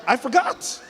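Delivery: father defending himself to his wife, high confident claim. Retold or imitated. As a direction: state it like it settles everything. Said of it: "he said it with such confidence".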